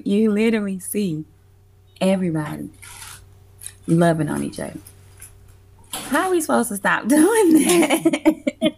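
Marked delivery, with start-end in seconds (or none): 5.9-8.7 high laughing question through giggles; laughing disbelief. Real, not acted. Telling the host about the joint, laughing in delight. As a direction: laugh it off as unstoppable.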